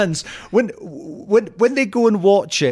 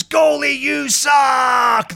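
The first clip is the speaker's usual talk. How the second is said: high, long drawn-out fan shout; stretched-out imitation terrace yell; mock heckle; 8 semitones higher, much slower than usual. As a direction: holler it slowly across the pitch, imitating a heckler. Same heckler shouting once more.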